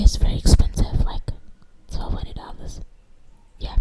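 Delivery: breathy confiding whisper, almost no voice; hushed whisper. Real, not acted. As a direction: whisper the price like a secret. Confiding the shocking price.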